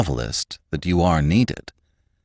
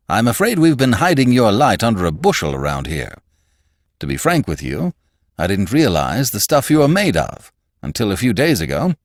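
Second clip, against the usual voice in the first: lightly voiced Gowler, louder opening; confiding boss; 5 semitones higher, 4 dB louder than usual. A narrator's reading. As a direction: flatter the employee, a little pitched up.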